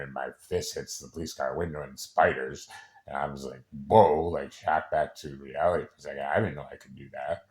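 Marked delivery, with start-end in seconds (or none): 3.9-4.3 loud, startled "whoa"; sudden loud exclamation of shock. Retold or imitated. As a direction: blurt it out loud, jolted back to reality. Acting out shocked return to reality.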